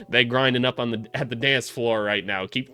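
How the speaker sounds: ordinary talk, nothing marked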